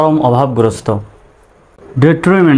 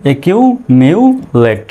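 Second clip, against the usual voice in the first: syllable by syllable. Shown as a demonstration. Teacher showing learners how breaking a word up helps, syllable by syllable. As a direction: pause between the parts of "এ কিউ মিউ লেট". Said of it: "এভাবে যদি আমরা ভেঙে ভেঙে".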